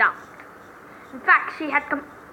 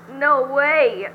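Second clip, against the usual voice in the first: ordinary talk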